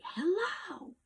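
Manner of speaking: forceful call. Shown as a demonstration. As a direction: put real energy behind "Hello". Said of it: "the energy I put behind the second hello".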